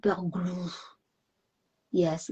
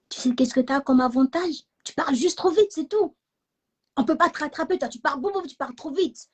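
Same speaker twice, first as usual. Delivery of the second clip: higher, playful teasing consolation; imitating a reassuring mother; 6 semitones higher, 3 dB louder, faster than usual. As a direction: lift the pitch, cheer the child up. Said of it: "Ma mère, elle m'a dit".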